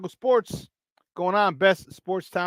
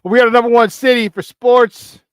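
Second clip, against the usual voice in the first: hype shout; 7 semitones higher, 9 dB louder than usual. Real, not acted. Hyping Chicago to the stream.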